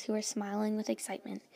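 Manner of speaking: plain reading voice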